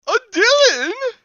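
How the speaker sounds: shrill, very high, loud, slow scream. Acted. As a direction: shriek it in disgust. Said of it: "she screamed".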